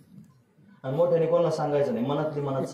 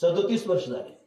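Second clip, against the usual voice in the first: raised, driving sermon delivery; preaching at full force; 3 semitones higher than usual. Acted. Kirtan preacher rebuking listeners at full force. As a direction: press the congregation harder each line.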